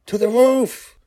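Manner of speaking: high, loud insistent call; urgent father. Acted. Father directing daughter to the roof, loud high call. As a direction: point her up there, loud and high.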